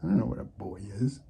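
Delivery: soft, low mock chick voice; a hint of puzzled baby birds; voicing the chicks. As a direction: lightly play the clueless chicks. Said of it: "They're like".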